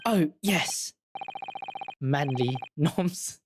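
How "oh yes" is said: ordinary delivery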